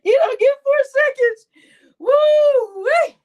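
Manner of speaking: a real cackling yell; laughing shout. Real, not acted. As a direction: crack up while shouting it out. Laughing at his own story.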